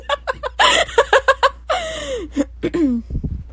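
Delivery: high helpless cackle; laughing fit. Real, not acted. Host amused by fries and Sprite lyric, laughing. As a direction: burst out laughing.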